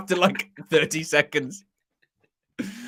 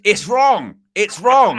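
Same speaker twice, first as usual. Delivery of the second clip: put-on higher, louder Bristolian accent; Ian Holloway impression; 6 semitones higher, 7 dB louder, much slower than usual. Pundit imitating Ian Holloway, Bristolian accent. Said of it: "loads of Ian Holloway ones".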